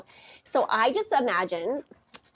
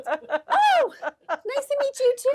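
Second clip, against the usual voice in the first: mock sugary reply; 4 dB louder, slower than usual. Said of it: "And I was like".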